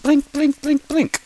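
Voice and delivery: high, evenly spaced blips; high sound-effect voice; imitated blinking. Shown as a demonstration. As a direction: pop each word out like a blinking light. Imitates lights blinking in sequence.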